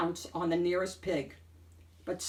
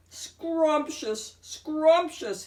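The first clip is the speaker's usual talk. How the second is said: higher, long drawn-out relish; hungry wolf exclaiming, higher and louder; drooling exclamation; 9 semitones higher, 5 dB louder, much slower than usual. A narrator's reading. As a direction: savour each word like a meal. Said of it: "he exclaimed".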